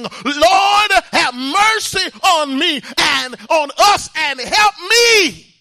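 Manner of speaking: high, loud, pleading cry to the Lord; shouted plea for mercy. Acted. Mother begging the Lord for her child, loud pleading cry. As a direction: cry out for mercy with everything you have.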